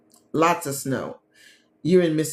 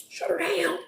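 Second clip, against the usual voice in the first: mock panic; 12 semitones higher, 3 dB quieter than usual. Mocking snow-day panic.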